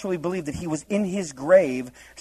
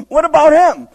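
much higher and louder, a whiny protest; indignant Peter; 11 semitones higher, 12 dB louder than usual. Retold or imitated. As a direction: point and complain like a jealous kid. Peter pointing at John.

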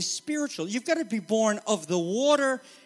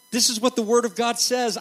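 usual speaking voice

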